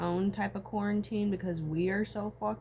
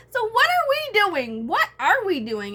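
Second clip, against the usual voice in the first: louder and higher, slow and exasperated; exasperated shout; 12 semitones higher, 12 dB louder than usual. Real, not acted. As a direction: yell it at everyone in disbelief.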